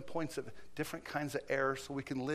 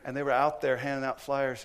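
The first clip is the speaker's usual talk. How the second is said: ordinary talk, nothing marked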